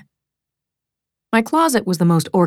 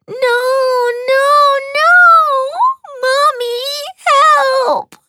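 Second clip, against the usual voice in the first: put-on wailing little girl; 5 dB louder than usual. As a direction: wail for mother like a frightened small child. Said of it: "Julie wailed".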